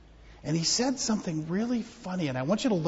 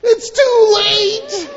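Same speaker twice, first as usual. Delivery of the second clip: high, loud, slow hammed-up wail; mock wailing shout; 15 dB louder, much slower than usual. Mourners telling Jesus it's too late, loud mock wail.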